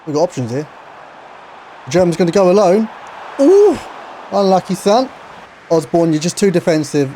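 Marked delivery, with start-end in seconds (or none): 3.4-3.8 high, loud gasping ooh; excited ooh. Real, not acted. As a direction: react to a shot just wide. Near miss in the match.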